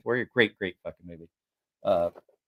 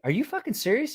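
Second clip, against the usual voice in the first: high-pitched, stunned retold question; incredulous disbelief; 11 semitones higher, 4 dB quieter than usual. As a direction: ask it in acted disbelief. Retelling own disbelief.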